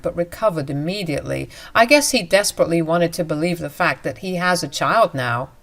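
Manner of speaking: plain reading voice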